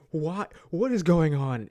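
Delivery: loud, high mock bewilderment; acted-out shock, rising to a loud outburst; baffled disbelief. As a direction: exclaim it like a stunned moviegoer. Acting out a viewer's baffled reaction.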